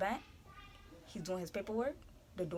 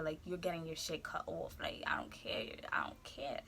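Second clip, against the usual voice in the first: whisper; 7 semitones lower, 13 dB quieter than usual. Real, not acted. Quiet aside whispering.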